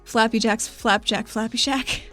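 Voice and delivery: ordinary talk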